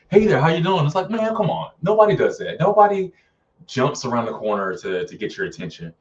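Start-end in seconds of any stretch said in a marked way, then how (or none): 0.1-0.8 higher, louder mock greeting; mock-cheery jump-scare hello; fake-scare greeting. Mocking movie fake-scare greeting. 1.1-3.1 high-pitched, incredulous complaint; exasperated, pitched well up; exasperated protest. Retold or imitated. Exasperated at cheap jump scares.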